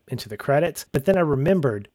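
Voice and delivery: ordinary talk, nothing marked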